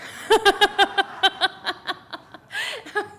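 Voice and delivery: high, loud burst of laughter; cracking up at own joke; big real laugh. Real, not acted.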